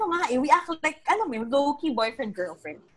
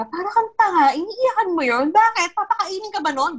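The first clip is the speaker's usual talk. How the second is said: higher, exasperated mock scolding; annoyed scolding; 7 semitones higher than usual. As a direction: scold her for crying over a ticket. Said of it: "nainis na siya sa akin". (inis na pagsaway)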